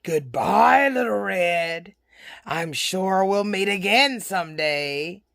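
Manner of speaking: slow, low wolf farewell; sly wolf. Acted.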